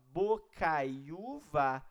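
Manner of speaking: very slow, every sound of Bocaiúva stretched; slow teacher demo word; drawn-out demo. Shown as a demonstration. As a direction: lay Bocaiúva out slowly for the class. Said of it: "Ó, vamos pegar esse aqui".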